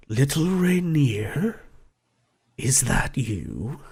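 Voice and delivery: slow unvoiced whisper, leaning in close; breathy dragon whisper. The gold dragon leans in and whispers to Rainier, hushed. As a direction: hush it like an old dragon recognizing a child.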